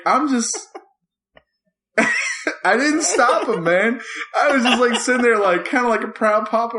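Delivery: ordinary talk, nothing marked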